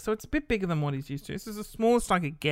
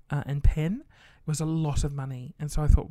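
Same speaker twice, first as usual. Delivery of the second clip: ordinary talk, nothing marked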